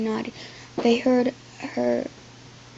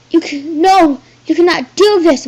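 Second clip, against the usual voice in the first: loud high anguished cry; mother crying out in protest; 6 semitones higher, 12 dB louder than usual. Acted. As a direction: cry out in desperation. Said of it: "Mother cried".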